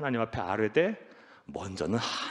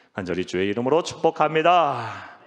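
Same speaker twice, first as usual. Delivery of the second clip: loud proclamation swelling on the last word; preacher's blessing; 7 dB louder than usual. Real, not acted. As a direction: pronounce the blessing, drawing out the ending.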